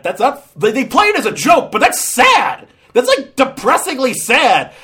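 loud, high-pitched protest; ranting in comic outrage; exasperated disbelief. Real, not acted. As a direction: voice shoots up in appalled disbelief. Ranting at a sad joke.

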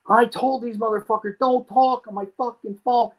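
high-pitched imitation of a furious man; retold angry rant. Dealer slamming the phone down at callers, angry rant. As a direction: act out his rage, pitched well up.

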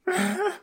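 high burst of laughter; sudden high laugh. Real, not acted. Laughs at insult banter.

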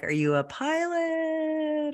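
high, drawn-out "pilot?"; tentative retold question. Acts out witnesses' wary question to ghost.

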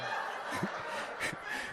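breathless unvoiced laugh; can't hold back the laugh; real laughter burst. Real, not acted. Preacher cracking up over corn on his forehead, laughing.

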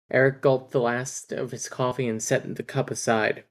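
ordinary delivery

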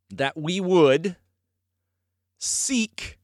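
very slow, word by word, long pauses between; preacher landing a point, slow with pauses; slow emphatic phrasing. Shown as a demonstration. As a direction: spell it out one weighty word at a time. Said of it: "How can I demonstrate that".

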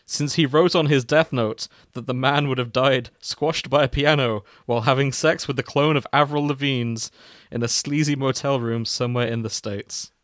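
ordinary talk

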